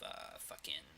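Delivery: whispered curse under breath; muttered. Real, not acted. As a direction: mutter it almost silently. Muttered curse mid-sentence.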